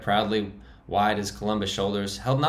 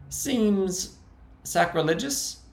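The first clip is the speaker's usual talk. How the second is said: much higher, squeaking; squeaky high voice; 11 semitones higher, much slower than usual. Acted. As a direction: defend the hideout in a high squeak. Frankie's held high voice.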